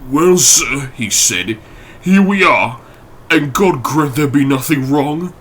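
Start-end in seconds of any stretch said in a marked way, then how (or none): 0.1-0.9 a touch harsh, a touch broken; nervous butler, slightly higher and harsh. Acted. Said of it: "he spoke harsh and broken". 2.0-5.3 a touch harsh, a touch broken; nervous butler, slightly higher and harsh. Acted. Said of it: "he spoke harsh and broken".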